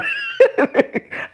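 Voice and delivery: squeaky breathless laugh; high airy wheeze of a laugh; delighted high giggle. Real, not acted. Laughing at Pacquiao's audacity.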